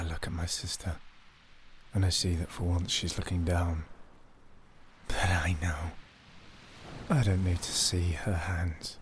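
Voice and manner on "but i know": whisper. A narrator's reading. Narrator confides what he knows, whispering. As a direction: breathe it like a secret.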